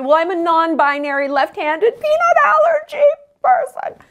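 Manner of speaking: opgeschroefde stem die steeds hoger klimt; mock whiny identity. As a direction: speel een overdreven zeurende persoon na. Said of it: "niche cat".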